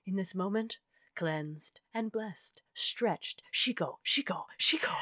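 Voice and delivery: soft, half-whispered ceremonial recital; hushed poem reading. Acted. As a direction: breathe the words like a ritual chant. Reads ceremonial sumo poem.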